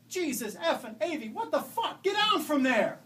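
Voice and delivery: put-on loud, high, shocked shouting at a child up high; a father screaming up the tower, scared and furious, shouting; furious yell. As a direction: bellow it up at her like a panicked dad. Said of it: "and he yelled up".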